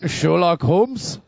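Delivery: hammed-up, drawn-out bad English accent; slow, loud mock-English voice; terrible English accent. Imitating a castmate playing Sherlock Holmes's companion, terrible English accent. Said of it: "his English accent is fucking terrible".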